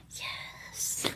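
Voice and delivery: hushed, whispered agreement; soft whisper, no voice; whispered yes. Retold or imitated. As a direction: imitate giving in under your breath. Retold grudging consent, hushed.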